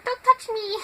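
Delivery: high, small shrinking voice; timid loner. Acted. Quiet person standing in the corner, timid high squeak. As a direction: act a shy person squeaking a plea. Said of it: "quiet, standing in the corner".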